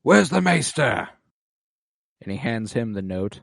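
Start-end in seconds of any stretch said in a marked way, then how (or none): 0.0-1.1 mock lordly demand, higher and louder; Boros demanding. Lord calling for his maester, mock gruff. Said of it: "Boros goes".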